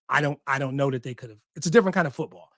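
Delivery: normal talking voice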